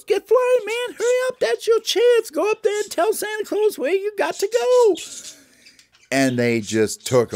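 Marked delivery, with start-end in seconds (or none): 0.0-5.0 very high, loud cartoon bird; frantic high-pitched urging; urgent Mexican bird. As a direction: shout them on in a shrieky-high bird voice. Bird urges the boys to fly.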